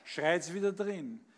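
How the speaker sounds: lively storytelling recital, acting out each voice; comic poem recitation. Reciting a comic poem for the birthday audience, performed recital.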